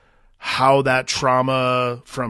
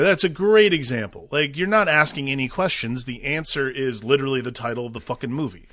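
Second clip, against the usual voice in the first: ordinary delivery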